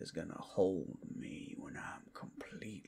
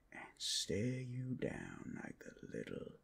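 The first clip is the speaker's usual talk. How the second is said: menacing whisper; 6 semitones lower, 8 dB quieter than usual. Acted. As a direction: breathe it at your prey. Predator taunting helpless captor.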